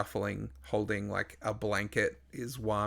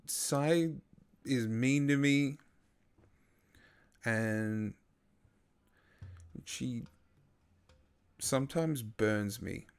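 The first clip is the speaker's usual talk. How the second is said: put-on child Wame confessing; 2 semitones higher, slower than usual.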